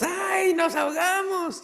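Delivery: high mock panic shout; panicked cry. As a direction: cry out as if drowning. Disciples panicking in the boat. (los discípulos gritan con pánico fingido)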